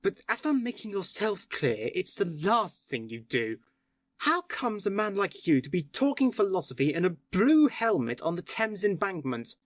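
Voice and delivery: needling retort. Acted. Syme needles the policeman.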